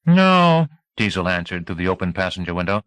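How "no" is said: sharp loud no. A narrator's reading. Teasel's sharp answer.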